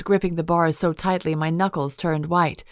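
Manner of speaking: ordinary delivery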